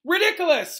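high, loud cry; cried out spell. A narrator's reading. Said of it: "cried Seamus".

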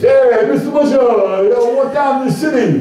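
high mock Bronx kid voice; kids mimicking Bronx accent. Retelling schoolkids teasing the teacher, mock Bronx accent. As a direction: play the kids teasing him about his accent. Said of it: "I couldn't teach there in my accent".

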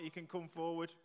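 ordinary talk, nothing marked

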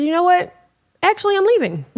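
high, pointed declaration; fed-up announcement. Retold or imitated. To her boyfriend while walking to the bedroom, high fed-up declaration. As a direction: pitch it way up, done with him.

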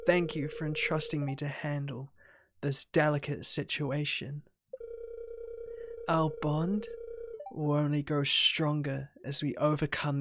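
soft, low game-character voice; devoted, ominous girlfriend. Acted. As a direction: lovesick and quietly menacing, voiced low. Reads game character's dialogue.

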